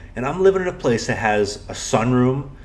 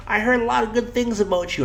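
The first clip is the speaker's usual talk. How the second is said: put-on high, frail old lady voice; 10 semitones higher, 3 dB quieter than usual. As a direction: play a sweet elderly woman. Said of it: "in her old lady voice".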